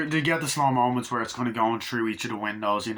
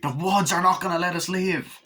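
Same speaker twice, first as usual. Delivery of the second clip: raised, dramatic imitated fear; mock dread warning; 6 semitones higher than usual. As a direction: warn them like a doomed horror heroine. Acts victim's dramatic warning.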